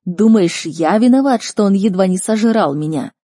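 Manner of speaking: offended, rising outburst; indignant protest. Acted. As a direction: protest the blame with heat. Accused father protests in a quarrel.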